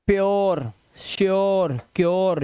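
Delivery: "प्योर, श्योर, क्योर" with rounded -yor. Shown as a demonstration. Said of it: "यू की आवाज इसने देखो ओ".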